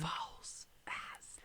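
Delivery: unvoiced spooky whisper; dramatic whisper. Listener echoing the reveal in a dramatic whisper. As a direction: hush it like a revelation.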